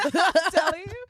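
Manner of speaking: high, loud, drawn-out laughing exclamation; laughing agreement. Real, not acted. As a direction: agree loudly through real laughter. Laughing over shared accent complaint.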